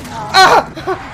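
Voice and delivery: high, loud shriek of fright; startled scream. Real, not acted. Startled by the killer.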